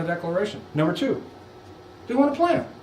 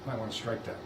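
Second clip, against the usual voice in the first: much softer, lower conspiratorial aside; hushed clerk aside; 8 semitones lower, 15 dB quieter than usual. Pretend. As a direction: murmur it as her clerk advising her. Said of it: "if I was her clerk, would have said".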